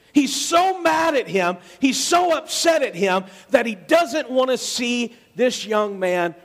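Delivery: high, swelling sermon climax; preaching at full force. Acted. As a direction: lift them with the father's joy.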